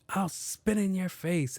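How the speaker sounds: high, slow mocking kid voice; rude kid. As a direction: drawl the kid's threat up high. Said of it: "this young kid was like".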